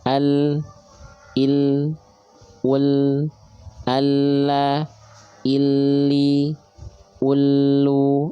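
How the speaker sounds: al, il, ul, alla, illi, ullu sounded out slowly and clearly; teacher drilling the letter lam: al, il, ul then alla, illi, ullu; drilling lam syllables. Shown as a demonstration. Quran teacher drilling students on lam, syllable drill. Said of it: "teacher drills lam vowels".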